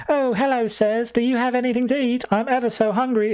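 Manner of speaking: put-on high, sweet little girl voice; creepy little girl. Young girl begging the strangers for food in a high little voice. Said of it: "And a young girl approaches saying".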